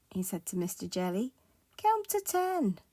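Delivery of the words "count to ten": high, lilting parting advice; sing-song kindly reminder; encouraging reminder. Acted. Tramp leaving Mister Jelly, encouraging reminder.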